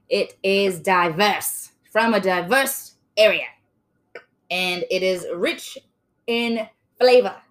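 slow, loud mock Australian pitchman; Australian accent. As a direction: draw it out in a put-on Australian accent. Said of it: "You gotta hear the accent".

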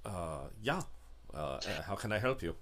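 low, soft, halting driver voice; quiet, low-pitched hired driver; hesitant driver. Acted. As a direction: deferential and hushed, stumbling into the offer. Game master answers as the driver.

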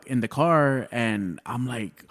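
usual speaking voice

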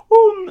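high squealing mock groan; imitating the producer's anguished oh; Scottish colleague's groan. Mimicking his producer's dismay at Trump, high anguished oh. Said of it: "and she's Scottish, and went".

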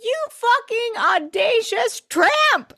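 very high, drawn-out outraged insult; mock scream. Host screaming what Nate should have said, slow mock scream. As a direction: stretch it into a high-pitched theatrical screech. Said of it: "That's what I wanted him to scream".

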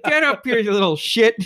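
high, loud scolding; mock angry order. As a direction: imitate a cousin yelling down at you. Cousin's retold order after being flipped off.

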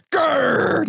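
playful animal growl. Shown as a demonstration. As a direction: growl like a mountain lion. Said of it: "you do yours".

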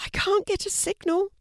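frantic pregnant woman pleading for help; panicked woman in labour. A narrator's reading. Stacy in labour.

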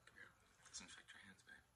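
whispered aside to babe. Real, not acted. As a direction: drop to a whisper for the partner. Quiet aside to partner.

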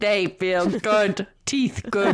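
slow, hammed-up delivery; silly put-on voice. Reader mocks the teeth line for laughs, slow silly voice. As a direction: say it slowly in a goofy put-on voice.

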